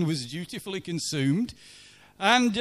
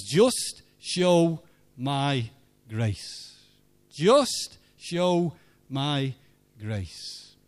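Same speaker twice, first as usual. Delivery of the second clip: slow gentle whisper of grace; 4 semitones lower, much slower than usual. Retold or imitated. Voicing the Lord's gentle prompting.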